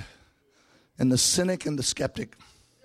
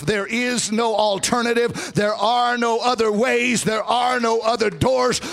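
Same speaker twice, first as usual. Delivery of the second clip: high shouted preaching whoop; southern preacher; 6 semitones higher, 3 dB louder than usual. Acted. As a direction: shout and sing it to the crowd. Preaching climax, sung and shouted.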